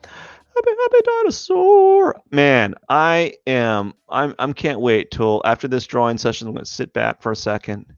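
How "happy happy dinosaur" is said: much higher, cartoonish squeak; squeaky high voice. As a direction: squeak it out like a toy. Repeats line in squeaky voice.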